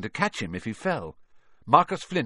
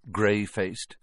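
plain reading voice

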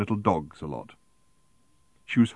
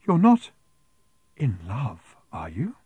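delighted worry; 4 semitones higher, slower than usual. A narrator's reading. Woman asking the couple, delighted ecstasy of worry. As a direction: pause before the delicate words, thrilled. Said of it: "in a delighted ecstasy of worry".

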